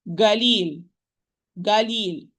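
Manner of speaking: galil with a hard g in place of the qaf. Retold or imitated. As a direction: imitate the dialect ga for qaf. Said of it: "They say galil".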